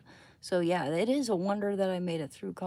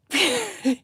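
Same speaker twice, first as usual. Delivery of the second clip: burst of laughter; 6 semitones higher, 11 dB louder than usual. Real, not acted. Laughing at herself.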